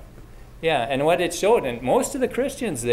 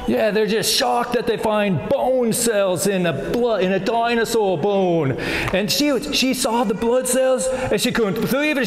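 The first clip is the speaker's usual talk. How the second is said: high, slow and emphatic Aussie showman; Australian accent; 6 semitones higher, 3 dB louder than usual. Acted. Retelling a blood cell discovery to children, Australian accent. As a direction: play up a big Australian accent. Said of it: "we kept the Australian accent".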